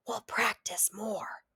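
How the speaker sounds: slow secret whisper into an ear. A narrator's reading. Brother whispers to sister, soft secret whisper. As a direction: breathe it close to her ear. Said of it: "he whispers into my ear".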